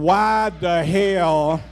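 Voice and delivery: slow, loud scolding parent; exasperated parent scolding; scolding bad children. Shown as a demonstration. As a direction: drag it out at your bad kids. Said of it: "like you said when you talking to your bad children".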